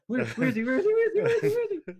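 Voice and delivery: comic panic on the edge of laughter; mock freaking out. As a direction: act out frantic searching, voice shooting up. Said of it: "he's still freaking out".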